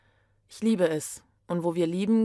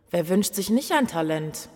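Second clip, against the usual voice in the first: ordinary delivery, nothing marked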